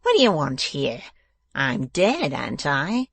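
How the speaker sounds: lightly voiced spiteful dwarf; a touch of menace; nasty dwarf. A narrator's reading. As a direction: demand it as a surly husband. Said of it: "returned the dwarf".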